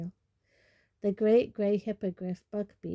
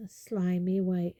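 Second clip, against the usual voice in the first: ordinary delivery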